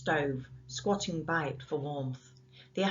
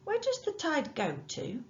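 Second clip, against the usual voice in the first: a bit timid; 11 semitones higher than usual. Acted. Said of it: "a bit timid".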